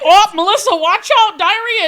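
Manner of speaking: high, loud call to a friend; retold warning call.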